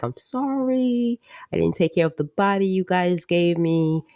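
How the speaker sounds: high, slowed sheepish apology; mock apology voice. Joe apologizing to his parents, high mock sheepish. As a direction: play it as a sheepish son. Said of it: "He's like".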